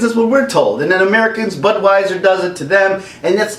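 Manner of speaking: ordinary delivery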